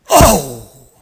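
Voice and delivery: a loud, sudden "oh" when hit; shocked shout. Retold or imitated. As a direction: cry out as if winded by a blow. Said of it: "I went".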